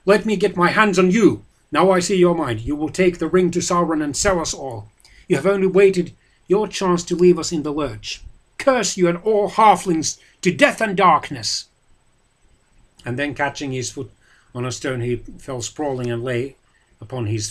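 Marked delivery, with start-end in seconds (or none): 0.0-11.6 loud, higher raging voice; furious curse. A narrator's reading. Boromir cursing Frodo, loud and raging. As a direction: curse him with all your anger.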